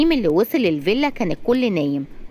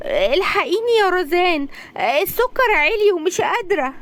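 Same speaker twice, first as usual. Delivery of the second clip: feigned weak mother; 11 semitones higher than usual. Acted. Mother fakes illness.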